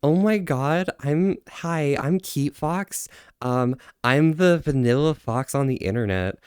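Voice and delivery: high, drawn-out impression of a streamer; put-on fox persona introducing itself; Keat Fox.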